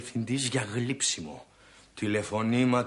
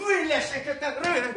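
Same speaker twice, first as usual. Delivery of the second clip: mock taunt; 13 semitones higher than usual. Boasting husband taunting rich relatives.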